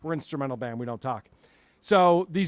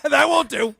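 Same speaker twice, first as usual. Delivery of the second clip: higher, louder catchphrase with a laugh in it; catchphrase on request; 8 semitones higher, 7 dB louder than usual. Shown as a demonstration. Answering the host's question with the requested catchphrase, laughing. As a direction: belt out the line through a laugh. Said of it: "I did what I was told to do".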